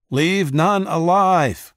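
high, loud, slow battle cry; slow ruthless war cry; distant yell. A narrator's reading. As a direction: bellow the order across the lines. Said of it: "yelled someone he couldn't see".

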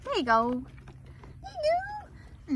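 very high cooing voice for the dog. Real, not acted. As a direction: coo it up high like to a puppy.

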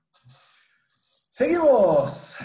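loud, high, stretched-out call. Real, not acted. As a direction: draw the word out loudly to rally the room. Calling the class to move on.

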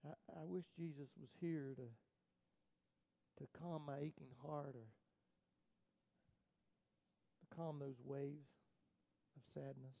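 slow, soft and low; grieving. Acted. Preacher mourning, wishing Jesus would calm his grief, slow and soft. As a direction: let the sorrow slow every word.

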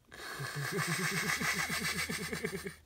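long, high, rapid stuttering cackle; hammed-up drawn-out joking laugh; terrible laugh. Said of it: "that was a terrible laugh".

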